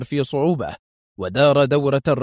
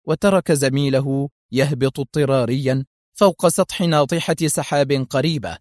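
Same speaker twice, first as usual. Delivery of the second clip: usual speaking voice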